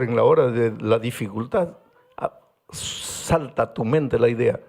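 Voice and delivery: usual speaking voice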